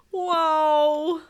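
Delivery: long, loud, high cry; alarmed yell. Acted. Boy tripping over the chair, long loud yell. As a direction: yell it as you lose your balance.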